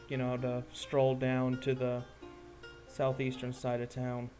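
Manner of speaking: ordinary delivery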